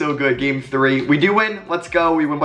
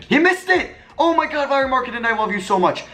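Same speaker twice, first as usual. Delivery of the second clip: much higher, gushing with joy; thrilled the shot missed; overjoyed relief; 12 semitones higher than usual. Real, not acted. Opponent misses crucial shot.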